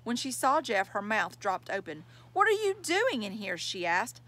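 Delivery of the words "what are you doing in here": startled. Acted. Girl startled by boy.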